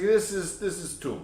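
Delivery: high, loose Egyptian accent impression. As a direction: mimic an Egyptian guard's accent. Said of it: "my Egyptian attempt".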